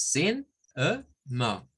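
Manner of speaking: sin, e, ma split into three syllables. Shown as a demonstration. Teacher spelling out the syllables for students. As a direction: break sin, e, ma apart. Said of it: "son tres sílabas".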